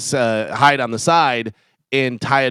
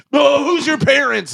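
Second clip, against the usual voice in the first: angry adult; 12 semitones higher, 3 dB louder, slower than usual. Retold or imitated. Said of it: "some like".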